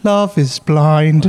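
mock slow impression of him. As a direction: stretch it slowly, lifting the pitch. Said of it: "You know what they say?".

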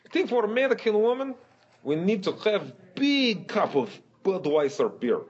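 put-on high, heavy Russian accent. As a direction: explain like a Russian bro.

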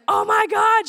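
high, loud shocked outburst; retold scream. Woman showing friends the email, retold scream. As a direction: cry it out to the whole room. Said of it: "So I literally scream".

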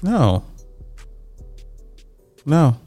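ordinary delivery

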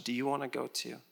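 soft and quiet, calm and loving; a quiet loving question to the disciples; gentle, confident question. Retold or imitated. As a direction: ask it tenderly without pressure. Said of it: "so confident, yet so loving".

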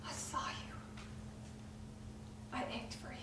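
hushed and aching compassion; Jesus whispering love to the woman; tender whisper. Acted. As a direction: whisper comfort to a hurt child.

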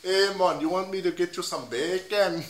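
hammed-up Jamaican offer of bacon; Jamaican accent. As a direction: put on a mock-Jamaican voice. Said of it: "Give me Jamaican".

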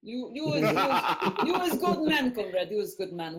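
put-on laughing broken Russian accent; mock Russian with a laugh. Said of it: "speaking with the American pig accent".

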